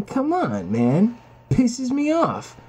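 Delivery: normal talking voice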